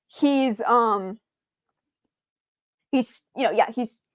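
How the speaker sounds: halting, with false starts and fillers; stuttering. Real, not acted. Streamer losing the thread while listing a character's flaws, stuttering. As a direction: stumble and search for the next word. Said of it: "I'm stuttering".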